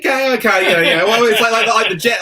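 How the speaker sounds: high, loud, wavering objection; playful hedging. Real, not acted. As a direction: squeal out a hesitant half-agreement. Balks jokingly at co-host's comparison.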